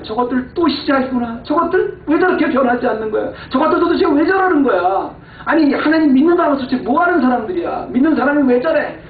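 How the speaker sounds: high-pitched exasperated rant; acted outburst of fed-up anger, pitched well up; Moses angry. Moses fed up with the unchanging people, acted angry rant. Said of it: "모세가 사람들한테 화가 난 거야".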